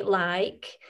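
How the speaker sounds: "like" with a slow, overpronounced vowel; overpronounced Burnley vowel. Shown as a demonstration. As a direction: stretch the vowel in "like", Burnley style. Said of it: "just overpronounce your vowels".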